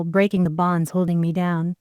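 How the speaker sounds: ordinary delivery, nothing marked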